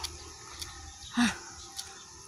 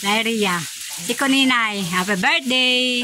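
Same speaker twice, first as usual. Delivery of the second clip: normal talking voice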